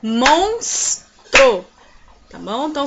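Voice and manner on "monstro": MONS, pause, TRO, loud; syllable by syllable. Shown as a demonstration. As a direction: split MONSTRO into two clapped beats. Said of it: "como vamos separar a palavra monstro?".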